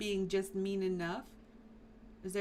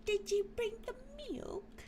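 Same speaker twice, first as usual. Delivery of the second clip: put-on much higher mum voice in a British accent; 13 semitones higher, 3 dB quieter than usual. Streamer voicing the game's mum asking for milk, high British accent. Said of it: "She's British, by the way".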